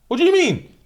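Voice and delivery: high, loud accusing yell; furious yelling. Retold or imitated. Girlfriend yelling at boyfriend over a business card, furious. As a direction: scream at him about the card.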